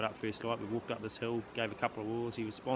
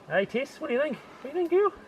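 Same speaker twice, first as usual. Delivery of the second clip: talking to a dog; 14 semitones higher, 7 dB louder than usual. Real, not acted.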